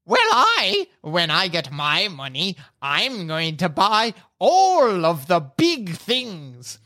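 dim-witted giant head boasting slowly; slow, booming brute talk; two bickering giant voices. Acted. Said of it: "catch the end of a conversation".

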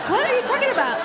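high, laughing disbelief; incredulous mom. Retold or imitated. Mom on the phone, baffled by the story, high and laughing. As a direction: ask it way up high, half laughing.

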